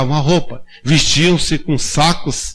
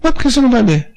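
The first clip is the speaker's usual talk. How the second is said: higher, lightly voiced boy asking why; a hint of a puzzled kid; boy's voice; 8 semitones higher, faster than usual. Boy asks the old brother why, lightly voiced higher kid. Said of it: "o piazinho invocou".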